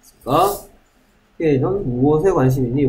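normal talking voice

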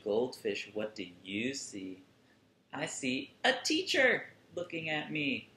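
ordinary delivery, nothing marked

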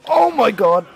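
high gasping oh my god; shocked cry; startled gasp. Real, not acted. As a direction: jolt of real fright. Jump scare in horror game.

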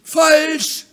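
loud, high, gleeful "Falsch"; triumphant. Acted. Hen triumphs over the duck's wrong guess. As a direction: crow over being right. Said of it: "triumphiert das Huhn".

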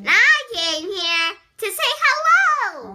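high, loud showy announcing; showy announcement. Pretend. Child performing for mom's video, loud high announcement. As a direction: proclaim it loudly, sweeping up high.

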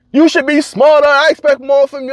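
high mocking parrot of the boss. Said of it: "And I was triggered".

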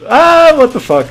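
high, loud yell; yelp, then complain loudly; startled outburst. Real, not acted. Gamer interrupted mid-explanation by trouble, startled yell. Killed while explaining his rule.

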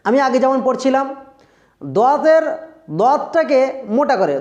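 normal talking voice

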